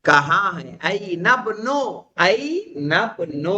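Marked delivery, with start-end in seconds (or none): 2.1-3.5 این ابن said very slowly, the alif of ابن still sounded; slow joined pronunciation. Shown as a demonstration. Teacher slowly repeating the phrase to students. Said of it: "الف پڑھا جا رہا ہے کہ نہیں".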